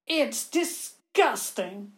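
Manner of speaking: slow, disgusted sneer; spitting with disgust. Acted. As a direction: spit the words out. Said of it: "almost spitting her words".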